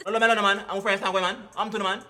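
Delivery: ordinary talk, nothing marked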